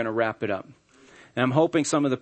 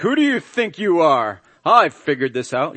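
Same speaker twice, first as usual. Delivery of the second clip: offended husband; 2 semitones higher, 6 dB louder than usual. Retold or imitated. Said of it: "Be like".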